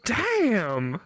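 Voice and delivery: ordinary talk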